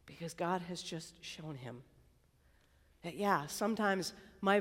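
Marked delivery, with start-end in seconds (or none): none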